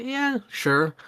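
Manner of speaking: high, dismissive yeah sure; sarcastic agreement. Retold or imitated. Answering fans who call Witcher dark, sarcastic. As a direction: brush the claim off with a sarcastic lilt. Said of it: "and I would say".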